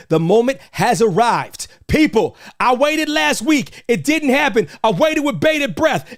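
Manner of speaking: high, loud hype building to the reveal; excited hype. Real, not acted. Host revealing the main event to his viewers, high excited hype. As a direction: build it up, then explode on the reveal.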